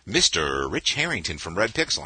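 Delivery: big, bright show-opening announcer read; radio announcer voice. Acted. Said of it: "You've got such a great radio voice".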